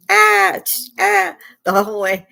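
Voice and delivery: sudden loud high scream of pain. Retold or imitated. Jolted in the ambulance over potholes, loud high screams. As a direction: cry out at every pothole.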